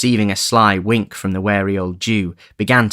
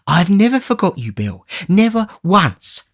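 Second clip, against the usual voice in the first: put-on much higher, pleading old man; 11 semitones higher, 3 dB louder than usual. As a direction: soothe him meekly.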